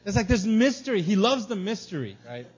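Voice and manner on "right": usual speaking voice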